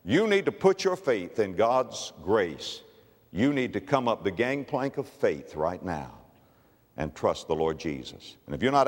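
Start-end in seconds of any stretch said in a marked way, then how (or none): none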